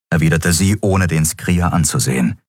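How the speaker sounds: usual speaking voice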